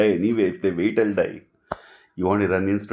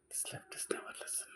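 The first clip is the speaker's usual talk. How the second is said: a hushed jab under the breath; whispered aside; 20 dB quieter than usual. Real, not acted. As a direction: whisper it as a side remark. Aside about the left.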